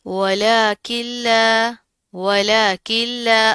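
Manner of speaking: "वलाकिल्ला" slow, "ला" held one beat; slow recited "वलाकिल्ला", evenly stretched; measured madd. Shown as a demonstration. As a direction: chant "वलाकिल्ला" with the n merged into a doubled l. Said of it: "एक अलिफ के बराबर पढ़ेंगे".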